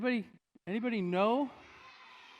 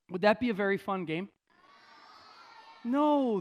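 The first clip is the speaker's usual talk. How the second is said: normal talking voice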